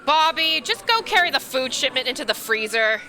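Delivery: bossy mom voice ordering a coworker; angry mom tone. Acted. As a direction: boss him like an angry mother. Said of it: "you sound like an angry mom".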